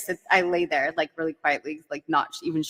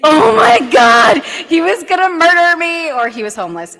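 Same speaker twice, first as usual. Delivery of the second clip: retold panicked shout; 11 semitones higher, 14 dB louder than usual. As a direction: shout it in dramatic high-pitched horror. Retold panic after getting his text.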